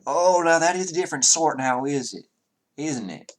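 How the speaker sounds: formal Southern-gentleman voice; Southern character, courtly. Acted. Townsman asking party to return holy text, courtly Southern voice. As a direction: speak as a genteel Southerner making a solemn request.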